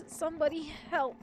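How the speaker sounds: high-pitched, pleading call; cry for help. A narrator's reading. Injured narrator alone at the door calls for help in high, pained distress.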